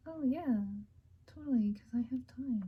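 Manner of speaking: whispered sarcasm, voice dropping away; mock agreement, hissed out under the breath; sarcastic reply. Mother whispering a sarcastic yes to her kid.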